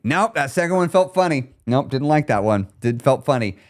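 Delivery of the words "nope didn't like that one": ordinary delivery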